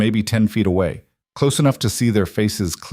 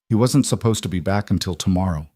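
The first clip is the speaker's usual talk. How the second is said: plain reading voice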